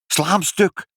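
high, urgent command; calling out. A narrator's reading. Mother orders father to smash the machine, calling out. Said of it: "riep moeder".

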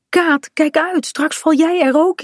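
high-pitched, anxious warning. A narrator's reading. Bink sees Kaat hanging over the edge, warning her. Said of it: "waarschuwt Pink".

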